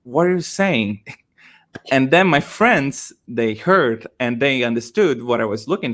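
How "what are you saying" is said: higher-pitched puzzled question; confused. Retold or imitated. Cashier failing to understand a customer's request, confused. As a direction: imitate a baffled cashier. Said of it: "the cashier was confused".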